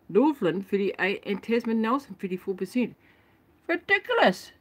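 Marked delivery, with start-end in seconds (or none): 3.7-4.5 high, indignant exclamation; exasperated. Real, not acted. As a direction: fling the word out in disbelief. Outraged at statistics.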